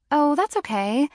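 high, light and breezy. A narrator's reading. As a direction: brush it off breezily. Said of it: "I said breezily".

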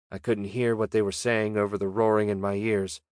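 usual speaking voice

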